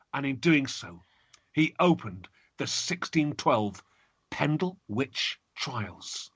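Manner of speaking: ordinary delivery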